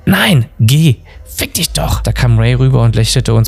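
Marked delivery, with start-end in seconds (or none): 0.1-2.0 heated quarrel. A narrator's reading. Bob and Peacock quarrel over buying records, annoyed. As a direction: snap back, then curse under the breath.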